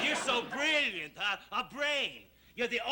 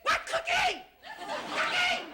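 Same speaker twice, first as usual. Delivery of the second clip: shrill, much higher disbelief; incredulous; 4 dB louder, slower than usual. Acted. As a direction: cry out in disbelief. Incredulous spouse in a sitcom quarrel.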